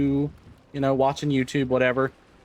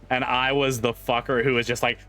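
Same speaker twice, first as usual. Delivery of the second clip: normal talking voice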